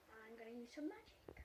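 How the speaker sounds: soft secret whisper. Pretend. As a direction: hush it like a secret. Kid magician whispering aside.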